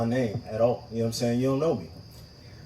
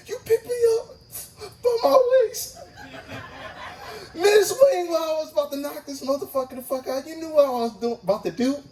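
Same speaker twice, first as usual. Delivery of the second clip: high, whiny, hurt protest; high comic indignant whine; squeaky outrage; 14 semitones higher than usual. Retold or imitated. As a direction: squeal it like a betrayed little guy.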